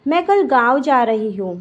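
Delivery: usual speaking voice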